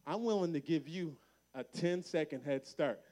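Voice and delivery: ordinary talk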